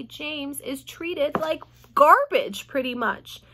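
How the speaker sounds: ordinary delivery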